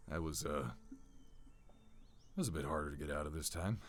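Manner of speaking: drained after the rage fades; exhausted recovery. Acted. Lucian catching his breath after raging, low and soft.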